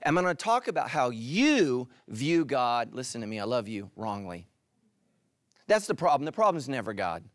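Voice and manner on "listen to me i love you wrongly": low, soft and reassuring; tender aside. Real, not acted. Preacher telling the congregation he loves them, tenderly. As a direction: drop low and soften the blow.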